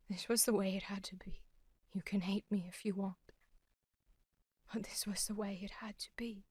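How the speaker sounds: hushed, broken whisper; sad whisper. Acted. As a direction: whisper it softly, resigned to what she did. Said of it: "Jay's sadness dialogue".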